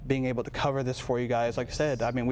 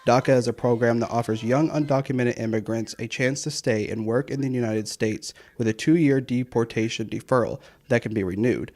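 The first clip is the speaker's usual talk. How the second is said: steady anchor-style report; news read; 5 dB louder than usual. Acted. Voice-over of news story.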